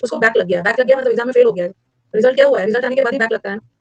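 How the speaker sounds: usual speaking voice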